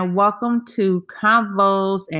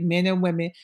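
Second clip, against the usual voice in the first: ordinary delivery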